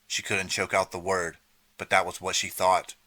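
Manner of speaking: ordinary delivery, nothing marked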